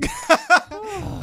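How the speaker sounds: very high, loud laugh; real sudden cackle; burst of laughter. Real, not acted. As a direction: explode laughing. Bursts out at baby remark.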